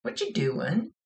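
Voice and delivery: put-on playful child voice; little kid. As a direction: ask like a pestering little sister. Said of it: "something that the little kid would say".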